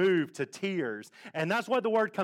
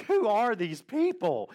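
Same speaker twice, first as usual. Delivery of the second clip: higher, slow incredulous question; bewildered; 5 semitones higher, slower than usual. Retold or imitated. Speaker reacting to friends crying at a movie, bewildered. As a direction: draw it out in retold disbelief.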